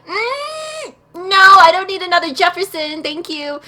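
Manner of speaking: high-pitched squeal into a loud no; hammed-up disgusted refusal; emphatic refusal. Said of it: "And I'm like".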